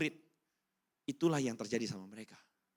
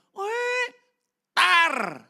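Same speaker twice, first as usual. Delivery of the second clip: much higher, louder shrill "weh, tar"; mother's scolding yell; 12 dB louder than usual. Retold or imitated. Mother yelling at her crying son while caning. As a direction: yell at the crying kid like mom.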